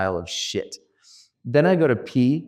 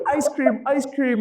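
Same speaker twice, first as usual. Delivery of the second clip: put-on much higher, childish chant of ice cream; friend's plea; 14 semitones higher than usual. Friend to speaker, pleading for ice cream. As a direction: beg for a treat like a kid. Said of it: "my boy's like".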